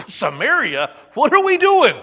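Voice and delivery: high, loud disbelieving protest; incredulous disciples. Acted. Disciples object to Jesus about Samaria, loud disbelief. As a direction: balk at the plan, loud and shocked.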